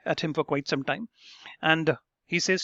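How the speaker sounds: ordinary delivery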